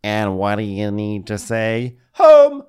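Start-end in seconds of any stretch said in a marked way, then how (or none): 2.1-2.6 high, loud and slow mock radio call; imitating the captain's radio call, high, loud and slow.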